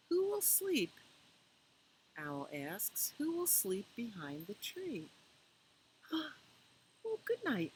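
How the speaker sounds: gentle, lilting picture-book read-aloud; reading to children. A narrator's reading. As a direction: read it slowly to little ones at bedtime.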